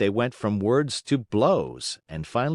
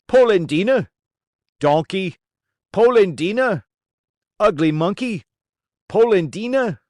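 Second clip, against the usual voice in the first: much higher, loud taunting back and forth; shouted insults; 10 semitones higher, 8 dB louder than usual. A narrator's reading. The two old men trade shouted insults, high and loud. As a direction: hurl the names at each other.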